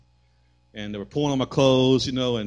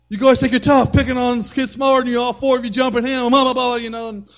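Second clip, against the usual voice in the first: high, loud scolding; father scolding boys who jumped his son; angry dad; 11 semitones higher, 5 dB louder than usual. Retold or imitated. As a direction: tear into the bullies.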